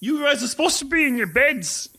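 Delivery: high-pitched, fussy telling-off; scolding professor. Acted. Professor tells students to stay by their beds, high and scolding.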